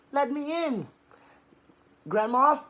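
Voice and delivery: high squeaky wolf faking sweetness; squeakiest voice. Acted. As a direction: squeak it out to trick Grandma. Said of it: "he said in his squeakiest voice".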